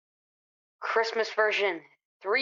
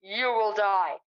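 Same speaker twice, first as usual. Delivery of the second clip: slow ominous; slower than usual. Acted. Slow ominous pronouncement with laugh.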